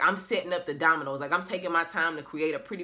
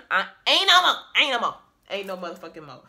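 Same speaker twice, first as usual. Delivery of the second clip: mocking refusal; 7 dB louder than usual. Noah refusing late pleas.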